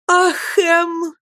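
put-on high, tearful cry of a young girl; a sobbing girl's high exclamation. A narrator's reading. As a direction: cry out his name through bitter tears. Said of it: "воскликнула девушка, горько плача".

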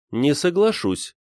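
firm refusal. A narrator's reading. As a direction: plant your feet, no argument. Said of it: "твёрдо сказала Анна Григорьевна".

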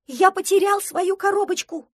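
high, loud duckling wail; distressed cry. Acted. Duckling realises his pudding box is lost, distressed cry.

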